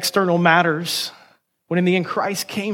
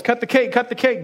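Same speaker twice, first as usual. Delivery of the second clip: raised-pitch mock cheer; mock yell; 7 semitones higher than usual. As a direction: egg them on in a mild acted yell. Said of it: "and yells".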